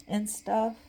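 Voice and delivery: ordinary talk, nothing marked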